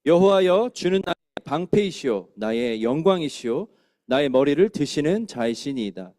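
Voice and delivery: loud, slow psalm read in unison; solemn unison psalm reading; congregational scripture reading. Shown as a demonstration. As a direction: lead the psalm together, slow and steady. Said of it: "우리 한번 같이 읽겠습니다".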